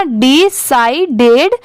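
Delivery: Decided slowly as 'di... sai... ded'; slow teaching डि-साइ-डेड, rising at the end; syllable by syllable. Shown as a demonstration. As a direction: break decided into three separate parts. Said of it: "तो पूरा क्या हो जाएगा".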